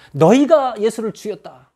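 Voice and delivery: loud, high-pitched accusation; rebuking. Acted. Preacher acts out Peter rebuking the crowd, rebuking. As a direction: throw the charge at the crowd. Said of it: "사람들을 책망하죠".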